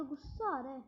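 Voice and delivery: normal talking voice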